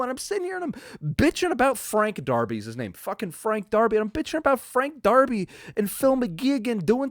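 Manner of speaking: high, loud frustrated rant; furious venting. Real, not acted. Fan venting about the Super Bowl champs' own team, furious. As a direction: yell out your frustration.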